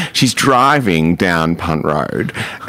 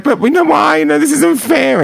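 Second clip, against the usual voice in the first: high, louder whiny protest; mock tearful plea; 4 dB louder than usual. Friend protesting to the police officer, mock tearful. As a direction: plead like a teenager in tears. She's crying.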